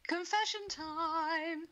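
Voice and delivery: slow drawn-out show announcement; announcer voice. Pretend. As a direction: announce it like a jingle. Announces segment jingle-style.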